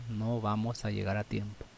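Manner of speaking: ordinary delivery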